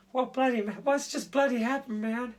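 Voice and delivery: high, alarmed mock panic of a shocked mind; panicked bewilderment. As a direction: voice the startled mind shooting up in alarm. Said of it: "So, so your mind goes".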